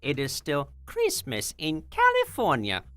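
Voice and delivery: bright high streamer greeting; chirpy welcome to the raiders; cute anime streamer. Acted.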